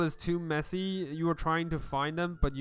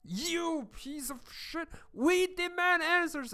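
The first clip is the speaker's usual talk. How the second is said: rioting crowd shouting insults; angry crowd shout; 13 semitones higher than usual. Acted. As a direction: hurl it like a brawling crowd.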